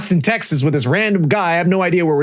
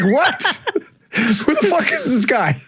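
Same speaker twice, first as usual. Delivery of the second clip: much higher, laughing "what?" of disbelief; shocked disbelief; 10 semitones higher than usual. Retold or imitated. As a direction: act stunned and amused at the stranger. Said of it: "You're like".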